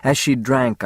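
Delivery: plain reading voice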